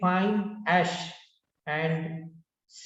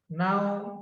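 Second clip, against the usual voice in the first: ordinary delivery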